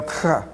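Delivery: "cha" said low, ch scraped in the throat; throaty ch sound. Shown as a demonstration. Teacher repeating the letter's sound for the class, throaty "cha". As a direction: demonstrate "cha" with a raspy back-of-throat ch. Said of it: "we pronounce that w-, letter as".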